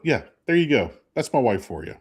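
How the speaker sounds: usual speaking voice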